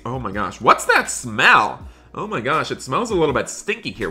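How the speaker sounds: usual speaking voice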